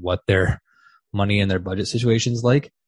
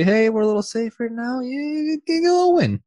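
much higher, put-on voice; high mock voice. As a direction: voice Chelsea as a teasing team. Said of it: "Chelsea can say".

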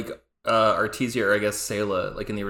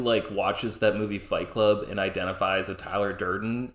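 ordinary talk, nothing marked

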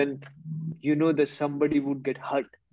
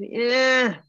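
mocking high retort; 5 semitones higher, slower than usual. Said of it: "I said".

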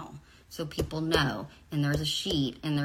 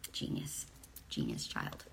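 whispered praise; 11 dB quieter than usual. Retold or imitated. Mock-praising her son's answer.

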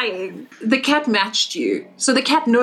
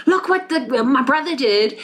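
put-on high, complaining child's voice; tattling older sibling; 3 semitones higher than usual. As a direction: tell on your brother to the parents, high-pitched. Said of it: "you call your parents and you're like".

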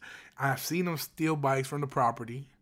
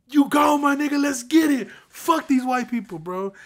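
loud, high hype-man holler; wild cheering; 9 semitones higher, 7 dB louder than usual. Retold or imitated. Manager's inner self rooting for the thief, wild cheering. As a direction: cheer him on at full voice, gleefully rowdy. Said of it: "I was cheering for him".

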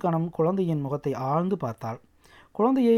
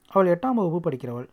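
plain reading voice

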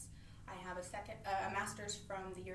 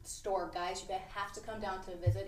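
ordinary talk

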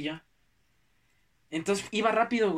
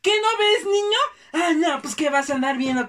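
high, loud, whiny tearful scold; shrill scolding; 8 semitones higher, 8 dB louder than usual. Retold or imitated. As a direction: scream it like a furious upset mother. Family scolds him again.

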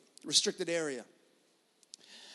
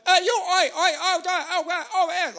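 higher, louder hammed-up shouting; mock patrol yell; 10 semitones higher, 5 dB louder than usual. Patrol guy yells at skiers out of bounds, hammed-up shout. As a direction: bark it like a ski patroller calling them out.

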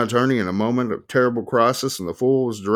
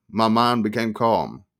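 ordinary delivery, nothing marked

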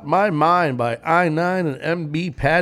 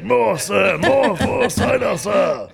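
higher, louder, slow shouted plea; pirate voice; 7 semitones higher, 4 dB louder, much slower than usual. Retold or imitated. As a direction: bellow for more cider like a pirate. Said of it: "that's why I was doing that voice".

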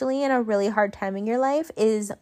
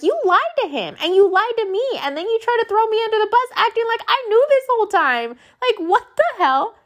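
much higher, louder, fast indignant scolding; real exasperated venting about the lie; heated rant; 11 semitones higher, 3 dB louder than usual. Real, not acted. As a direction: let the indignation build, pitched way up.

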